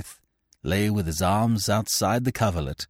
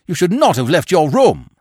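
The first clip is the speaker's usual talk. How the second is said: loud, higher scolding; reproachful cry; 9 semitones higher, 8 dB louder than usual. A narrator's reading. Petrie scolding the injured Smith for getting up, loud reproach. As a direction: chide him for his recklessness. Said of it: "I cried reproachfully".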